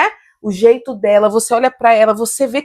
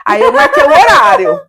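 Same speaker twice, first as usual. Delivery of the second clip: excited burst; 14 semitones higher, 6 dB louder than usual. Real, not acted. Retelling with sudden emphasis.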